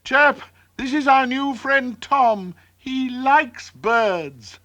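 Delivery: high, excited call of introduction; shouting. A narrator's reading. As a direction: call out to a friend in a high bird voice. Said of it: "Peep shouted".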